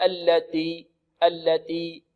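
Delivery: slow "التي" sounding almost like "alladi"; ta without hams. Shown as a demonstration. Teacher showing the forced error, unaspirated ta. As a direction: drag out "التي" with no air after the t. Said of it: "بالتاء بدون همس".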